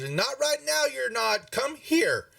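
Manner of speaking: high, insistent mom voice; mother yelling out. Acted. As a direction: order the boy over in a high pitch. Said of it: "she yells out".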